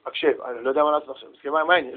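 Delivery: low, soft, hurried words; subdued father. Acted. Father finds only the child and backs down, subdued. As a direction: back down quietly after the outburst.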